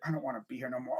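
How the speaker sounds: low, soft mumbled aside; reluctant aside. Acted. Fan imagining Joe Buck in the booth, reluctant and low. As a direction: drop it low, as if off the microphone. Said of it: "imagine him in the freaking booth".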